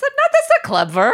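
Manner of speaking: high-pitched exasperation; exasperated high outburst. Real, not acted. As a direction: squeal it in disbelief. Exasperated about a clever name.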